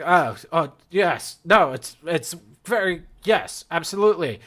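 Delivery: mock-British certainty; lightly voiced smart Brit. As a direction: play at suddenly clever British agreement. Voicing John suddenly sounding smart and British.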